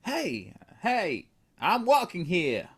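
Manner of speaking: high, loud put-on New York American accent. Shown as a demonstration. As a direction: call it out like a brash American New Yorker. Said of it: "in an American accent".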